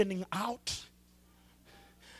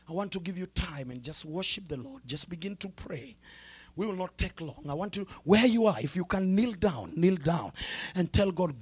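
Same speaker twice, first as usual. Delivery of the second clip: usual speaking voice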